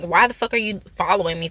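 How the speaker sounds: usual speaking voice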